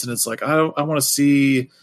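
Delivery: usual speaking voice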